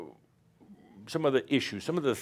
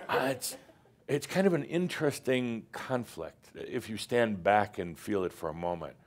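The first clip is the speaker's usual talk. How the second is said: usual speaking voice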